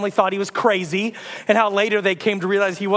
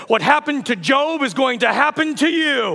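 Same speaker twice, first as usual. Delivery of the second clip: high, ringing prophetic warning; raised, declaiming prophet voice; doom-saying prophet; 5 semitones higher than usual. Acted. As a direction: pronounce doom on the listener. Acts out prophet's threat.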